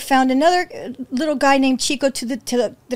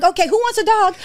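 high, chirpy mock announcement; eager retold offer; 8 semitones higher, 3 dB louder than usual. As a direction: offer it round to anyone. Her naive offer as newcomer.

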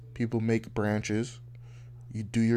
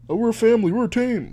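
mocking company voice; 11 semitones higher, 7 dB louder, faster than usual. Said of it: "That's really what this shit is about".

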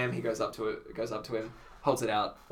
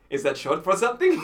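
laughing; 6 semitones higher, 7 dB louder than usual. Retold or imitated. Clown's retold question.

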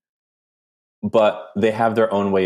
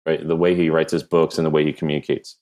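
ordinary talk, nothing marked

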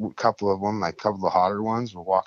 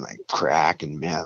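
usual speaking voice

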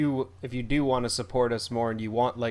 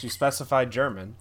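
usual speaking voice

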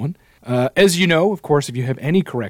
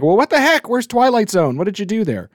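indignant listeners; 10 semitones higher than usual. Retold or imitated. Listeners asking why a game was left out, mock indignant. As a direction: imitate listeners demanding an answer. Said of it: "people were like".

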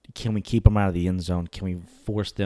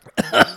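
sharp cough from water down the wrong pipe; choking cough; 8 dB louder than usual. Real, not acted. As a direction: cough out the water you choked on. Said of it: "choking on water".

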